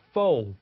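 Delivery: fold said clearly; teacher models fold for the class. Shown as a demonstration. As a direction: repeat fold crisply. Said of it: "따라해보세요".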